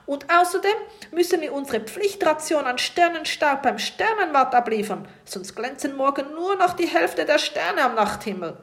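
viel höher und lauter; aufgebrachtes Schimpfen. A narrator's reading. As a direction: erregt weiterpoltern. Kapitän schimpft weiter. (Kapitän schimpft laut über die Sternenstaubpflicht)

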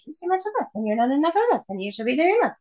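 lightly voiced nagging inner critic; mean girl voice. Voicing the inner mean girls that put women down, lightly mocking. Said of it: "those mean girl voices that are like".

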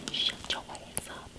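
hissed warning under the breath; sneaky whispered threat to a stranger's kid. Retold or imitated. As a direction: whisper it so the mom won't hear.

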